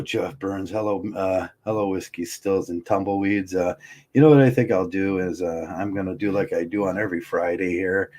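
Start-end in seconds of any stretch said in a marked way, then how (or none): none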